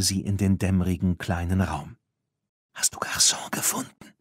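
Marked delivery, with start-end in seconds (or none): 2.7-4.1 unvoiced whisper; quiet whisper. Tina asking Claire in the shed, whispering. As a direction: ask it barely breathing. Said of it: "fragte Tina leise".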